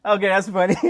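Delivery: bursting into a high laugh; real laughter. Real, not acted. Laughing hard at the viewer's comment about his accent.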